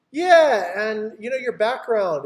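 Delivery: hammed-up client insult; mock client complaint. Client joking objection.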